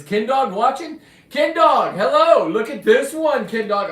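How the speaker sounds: loud, high-pitched hollering; excited calling out. Real, not acted. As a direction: holler an excited greeting to a buddy. Calls out to a viewer.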